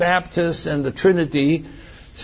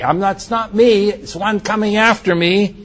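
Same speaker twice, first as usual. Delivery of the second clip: ordinary delivery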